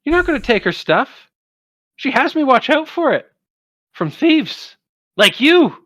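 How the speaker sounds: very high, quick squeaky scolding; ermine guard. Acted. Ermine scolding the party as thieves, very high squeaky voice. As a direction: squeak it out like a tiny protective critter.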